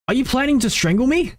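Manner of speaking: alarmed. Acted.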